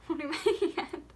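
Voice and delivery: laughing through the words. Real, not acted. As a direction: giggle as you say it. Laughing while telling.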